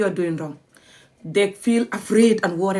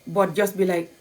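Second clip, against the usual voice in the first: ordinary talk, nothing marked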